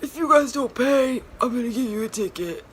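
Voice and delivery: loud, high put-on threat; mock stern attendant=107; parking attendant voice. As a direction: imitate the attendant warning them. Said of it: "He was like".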